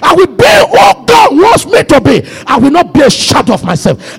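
forceful prayer declaration. Real, not acted. Preacher leading the last prayer point, forceful prayer declaration. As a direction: declare it forcefully, line after line.

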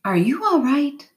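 a touch of strained concern, high; funny voice. A narrator's reading. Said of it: "In a funny voice".

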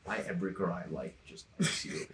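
low, hushed retold complaint; quiet grumble. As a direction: say it quiet as shit, under your breath. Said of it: "quiet as shit, like that".

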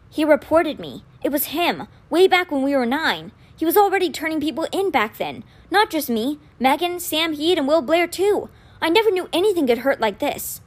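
put-on anxious, regretful young voice; upset young man. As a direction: pour it out in remorse. Alex hurt by betrayal.

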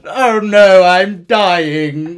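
hammed-up dying king. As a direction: fake a king's last breath, badly on purpose. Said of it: "That's pathetic".

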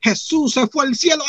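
high-pitched pastor's proclamation; pastor preaching. Retold or imitated. Pastor announces to the church, preaching voice. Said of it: "escucho que dice, "Pastor".

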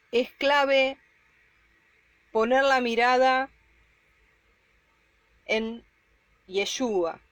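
fervent preaching. Acted. Preacher urges the brothers.

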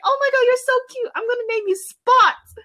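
high, quick cooing at a puppy; acted childlike excitement. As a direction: gush in a high little voice. Child naming new puppy.

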